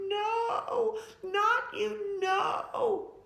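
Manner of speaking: high, loud crying no; terrified scream. Acted. Girl sees the robber and screams in terror. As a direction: shriek in horror at the robber. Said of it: "just started to scream".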